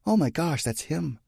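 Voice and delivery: put-on high, excited girlish voice; startled girl. A narrator's reading. Startled girl in narrated dialogue.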